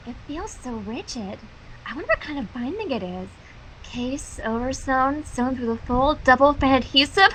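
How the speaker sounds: soft, breathy-free murmur of fascination; hushed dreamy reading. Acted. Reader murmurs Twilight's book lust, quietly entranced. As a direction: read it low and entranced, almost to yourself.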